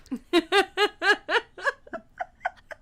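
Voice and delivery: high giggling laugh. Real, not acted. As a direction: break into laughter. Laughing at the punchline.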